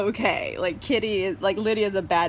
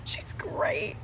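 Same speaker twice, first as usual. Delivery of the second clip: soft breathy whisper through a laugh; whispered aside; 12 semitones higher, 7 dB quieter than usual. Real, not acted. Reviewer whispers her praise of Lizzie to viewers. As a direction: whisper it like a secret.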